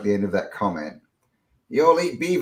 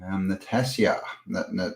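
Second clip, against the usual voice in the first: ordinary talk, nothing marked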